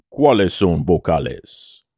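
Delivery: low, slow, self-assured Spanish; mock-proficient deep Spanish delivery; confident Spanish voice. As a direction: show off your Spanish in a deep, deliberate voice. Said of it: "said it in his confident, deep Spanish voice".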